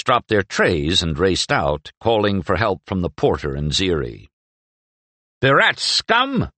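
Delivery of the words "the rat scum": high, furious yell, slowed; angry cry. Acted. Young man yells at his rival in the garden, furious cry. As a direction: spit out the insult at the rival. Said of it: "one cried".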